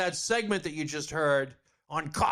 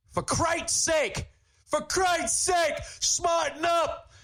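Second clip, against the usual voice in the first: slow, high furious yell; angry shout; 11 semitones higher, much slower than usual. Real, not acted. Radio host ranting at the university president, shouting. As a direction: bellow it at them, drawn out and high. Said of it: "I love yelling and screaming at people".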